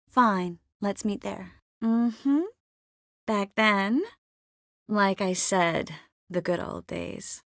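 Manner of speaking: ordinary talk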